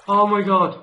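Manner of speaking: loud, high burst of amazement; amazed excitement. Real, not acted. Gamer spotting possible diamonds, amazed and excited. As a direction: exclaim at an incredible find. Spots what may be diamonds.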